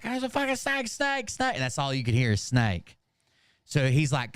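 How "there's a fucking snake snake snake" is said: high, panicky mock shriek, much higher; imitating panicked screaming. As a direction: imitate a sister freaking out over a snake. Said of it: "like, screaming".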